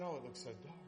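soft, fading scared whine; a hushed mock-fearful plea to Jesus; timid pleading. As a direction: shrink back and beg not to go. Himself balking, afraid, to Jesus.

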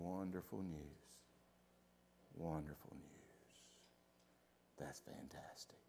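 very low, hushed deadpan; unenthused mock praise. Congregation responds to wonderful news, mock listless murmur. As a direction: barely voice it, sinking toward a whisper.

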